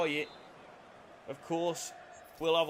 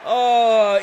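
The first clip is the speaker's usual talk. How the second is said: excited exclamation; 4 semitones higher, 15 dB louder than usual. Real, not acted.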